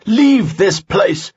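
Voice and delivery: slow, loud, high order; angry command. A narrator's reading. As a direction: banish them with force. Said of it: "he shouted".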